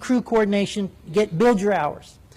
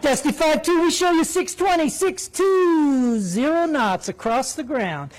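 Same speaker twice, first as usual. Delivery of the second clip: high, slow sing-song with a knife in it; gleeful controller; 7 semitones higher, slower than usual. Retold or imitated. Controller putting the Navy pilot in his place, high gleeful sing-song. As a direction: savour crushing the Navy. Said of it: "a little glee in the controller's voice".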